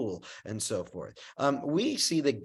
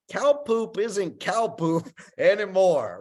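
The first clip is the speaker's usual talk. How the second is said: laughing mock amazement; 5 semitones higher, 4 dB louder than usual. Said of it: "And I'm like".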